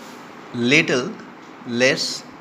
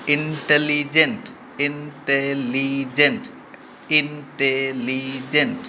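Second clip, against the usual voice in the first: Intelligent slowly split into four beats; syllable by syllable; 2 semitones higher, slower than usual. Shown as a demonstration. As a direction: stretch out each syllable of intelligent. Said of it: "four সিলেবলিক word". (Intelligent শব্দটি ধীরে চার সিলেবলে ভেঙে)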